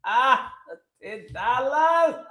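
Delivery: high and slow, stretching it out; can't believe the price; incredulous. Real, not acted. Streamer hearing of a ten dollar bill, incredulous.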